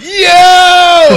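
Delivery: very high, loud whoop; whooped greeting. Retold or imitated. Comedian greeting the Irish boy, whooping shout. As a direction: let out a big long high holler. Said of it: "And then he went".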